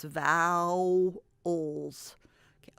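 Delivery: "vowels" stretched as VOW... ols; syllables sounded out. Shown as a demonstration. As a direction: draw out the OW in "vowels", then add the ending. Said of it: "O W says ow".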